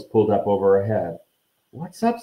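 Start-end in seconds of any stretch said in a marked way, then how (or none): 1.7-2.2 high, hesitant question; unsure, raised-pitch query; uncertain. A narrator's reading. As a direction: ask it tentatively, pitched up. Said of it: "said Ron uncertainly".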